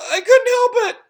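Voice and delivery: high, loud guilty blurt; flustered excuse. A narrator's reading. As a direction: blurt it out caught red-handed. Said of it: "said Paul".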